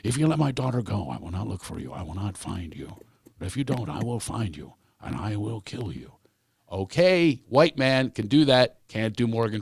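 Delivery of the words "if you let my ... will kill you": low, hushed menace of a film father; Liam Neeson. Acted. Comedian doing a movie threat to a kidnapper, low and menacing. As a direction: threaten quietly, dropping deeper and softer. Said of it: "Liam Neeson".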